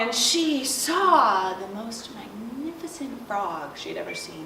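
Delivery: ordinary delivery, nothing marked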